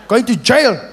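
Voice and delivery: lightly voiced officer telling off a drunk; police officer. Officer scolding Meneer, mock stern. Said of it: "police scene".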